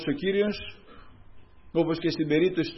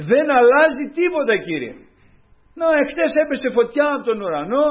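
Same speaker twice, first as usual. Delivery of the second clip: high, loud despairing cry to God; Elijah crying out; 9 semitones higher, 7 dB louder than usual. Retold or imitated. Elijah crying out to God in despair, loud. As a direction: cry out like the worn-out prophet. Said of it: "να φωνάζει και να λέει".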